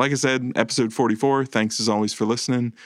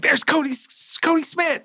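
put-on much higher, squealing like a thrilled child; excited kid shout. Kid finding the ornament first, squealing with excitement.

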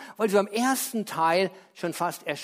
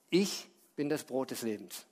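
solemn quote; 5 semitones lower, 5 dB quieter than usual. Acted. Jesus quoted solemnly in sermon.

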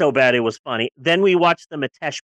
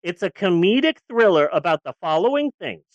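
usual speaking voice

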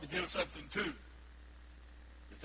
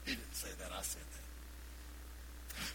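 whispered, much softer, slow; sly aside; 7 semitones lower, 10 dB quieter, much slower than usual. Real, not acted. Preacher admitting his own jab, whispered. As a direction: whisper the confession.

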